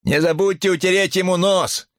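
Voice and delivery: very high, sustained jeering call; heckler's yell. A narrator's reading. Onlooker taunts the man wiping a face, high yell. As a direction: holler it out from the back of a crowd. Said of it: "заорал ещё кто-то".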